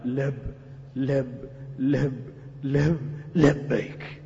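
"لب" caught and repeated before "لبيك"; halting, faltering. Shown as a demonstration. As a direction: stumble over "لب، لب، لب" then get out "لبيك". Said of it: "ولكن لا يلفظها بطلاقة".

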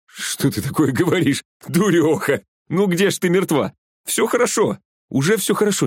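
high-pitched, insistent denial; worked-up, pitched well above normal; agitated reassurance. A narrator's reading. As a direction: scold fondly, then reassure urgently. Глеб refuses to believe she is dead.

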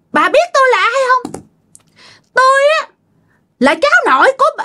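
very high, accusing possessed voice; shrill spirit voice. Acted. Possessed son reveals himself to his grandmother, shrill. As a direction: confront her in a piercing put-on pitch. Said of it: "bà nói với cái giọng the thé nha".

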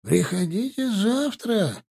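put-on high, slow sleepy old watchman; mumbling old man.

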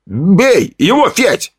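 high, slow, hesitant call to hit him; unsure shout from the crowd. Acted. A bystander unsure whether to egg Fedya on, calls out hesitantly. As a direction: egg Fedya on without much conviction. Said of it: "чей-то неуверенный возглас".

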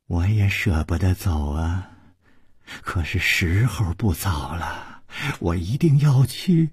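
low, soft and regretful; soft sighing. A narrator's reading. Xiong Niangzi sighing to her lover as she leaves. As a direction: let each phrase sink on a sigh. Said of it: "轻轻地叹息着说".

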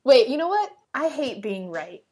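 normal talking voice

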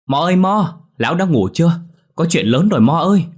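loud, high holler through a door; calling out. A narrator's reading. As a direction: call urgently to someone inside. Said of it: "gọi với vào bên trong".

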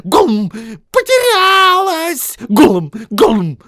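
angry Gollum. Acted. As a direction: spit it out with throaty gollums. Gollum snarls and gulps.

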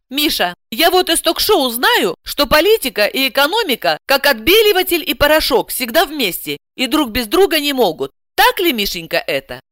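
high-pitched, knowing housewife; coaxing housewife. A narrator's reading. Client sharing talk-show wisdom with translator, coaxing. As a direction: lead the translator towards agreement.

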